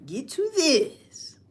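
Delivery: slow, drawn-out catchphrase. Shown as a demonstration. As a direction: stretch each word of "Get to this". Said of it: "Just like that".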